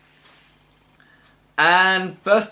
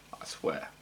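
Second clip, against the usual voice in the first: whispered; 17 dB quieter than usual. Real, not acted. Sighs after ranting about horror films.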